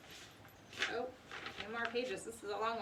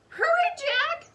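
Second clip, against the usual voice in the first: loud, much higher call; urgent cry; 12 dB louder than usual. A narrator's reading. Annie calls Jack from the ladder, loud urgent cry. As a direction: urge him on. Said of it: "cried Annie".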